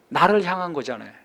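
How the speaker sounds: usual speaking voice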